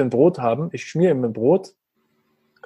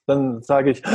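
ordinary talk, nothing marked

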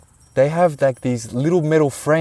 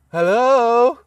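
high, drawn-out searching hello; calling out; 9 semitones higher, 3 dB louder than usual. Retold or imitated. Newcomer calling into an empty shed, calling out. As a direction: call out to see if someone's home.

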